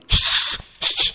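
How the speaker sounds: hushed, voiceless shushing; embarrassed father's "shh". Acted. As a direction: shush a child urgently. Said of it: "Papanya malu".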